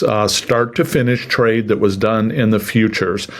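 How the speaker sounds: ordinary delivery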